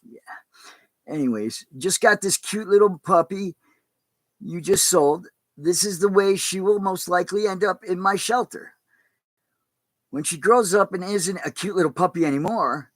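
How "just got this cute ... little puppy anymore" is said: higher, measured read-aloud voice; emphatic, higher-pitched letter reading. Acted. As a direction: read out the shelter manager's appeal.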